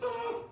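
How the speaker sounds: put-on sudden high yelp of a falling child; short high startled "ah"; startled child's cry. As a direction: cry out like a kid tossed in the air. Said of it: "That they would go".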